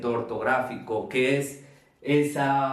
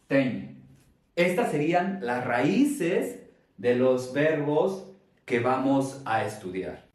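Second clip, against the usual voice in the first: ordinary talk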